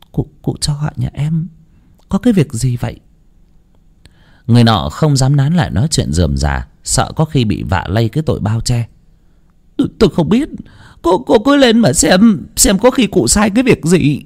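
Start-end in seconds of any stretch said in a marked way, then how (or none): 0.1-3.0 softer, frightened stammer; stammering fear. A narrator's reading. Servant girl asking why she is summoned, frightened stammer. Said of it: "miệng ấp úng". 9.8-14.2 nervous servant. A narrator's reading. Nervous servant evades question.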